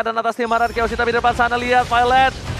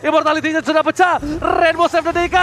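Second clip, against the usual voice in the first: fighting game announcer; 7 semitones higher than usual. Acted. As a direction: call the teamfight at full hype. Esports caster hype call.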